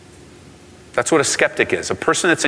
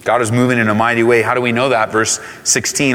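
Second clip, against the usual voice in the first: ordinary talk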